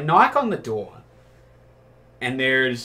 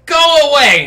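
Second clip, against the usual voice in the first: high, loud shout of go away; woman yelling from the door; angry yell; 13 semitones higher, 11 dB louder than usual. Retold or imitated. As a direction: yell to get rid of the pizza guy. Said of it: "and just goes".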